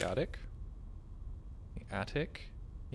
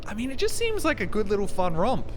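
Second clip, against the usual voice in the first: excited; 9 semitones higher, 6 dB louder than usual. Real, not acted. Gushing over a fun game.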